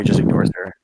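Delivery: ordinary talk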